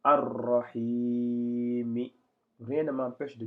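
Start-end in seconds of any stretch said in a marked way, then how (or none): 0.0-2.1 slow, ringing recitation with long held vowels; measured Quranic reading, syllables sustained; drawn-out demonstration reading. Shown as a demonstration. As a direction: stretch the long vowels and roll the r. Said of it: "bel et bien dire".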